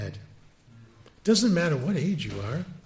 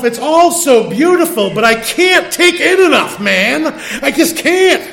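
high, loud acted outburst; overwhelmed exclamation; 8 semitones higher, 9 dB louder than usual. Sightseer to himself at beautiful mountain foliage, loudly overwhelmed. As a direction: cry it out, overcome by too much beauty.

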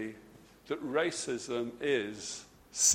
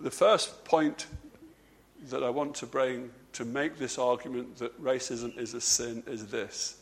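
usual speaking voice

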